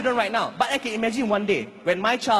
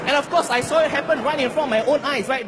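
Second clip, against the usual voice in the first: ordinary talk, nothing marked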